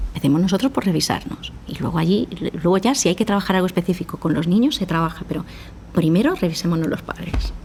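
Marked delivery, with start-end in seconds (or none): none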